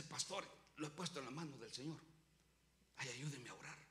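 very soft, low-pitched voice; hushed and low, confiding in the pastor; subdued quoted reply. Retold or imitated. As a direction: imitate a church member humbly asking for prayer. Voices troubled member answering pastor.

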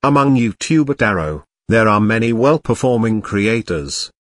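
ordinary talk, nothing marked

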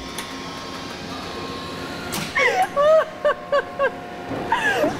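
high, soft, trailing laugh; real breathless laugh; long relieved laugh. Real, not acted. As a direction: laugh out the adrenaline. Reporter laughing after the ride.

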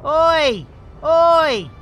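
loud, high Oi at a rival pilot; mock yell. Pilot yelling at a rival ship to move, loud. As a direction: shout him out of the way, cheeky.

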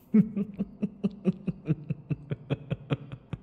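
a long, airy, amused laugh; long teasing laugh. Acted. Vampire laughing at his chained captive, teasingly amused. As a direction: laugh softly at the captive's discomfort.

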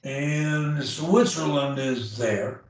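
slow, careful; slow model sentence. Shown as a demonstration. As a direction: model it slowly. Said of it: "model sentence".

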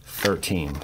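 usual speaking voice

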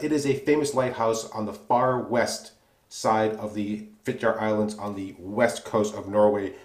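usual speaking voice

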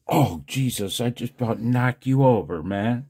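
ordinary talk

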